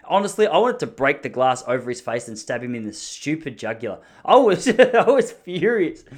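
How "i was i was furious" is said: laughing. Real, not acted. Laughing at own anger.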